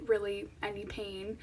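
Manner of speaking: ordinary delivery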